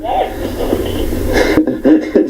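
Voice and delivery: breathy high cackle; high laughing fit. Real, not acted. Laughing after the impression.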